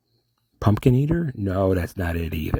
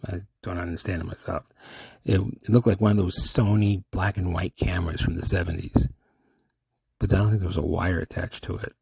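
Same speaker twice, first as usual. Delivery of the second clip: ordinary talk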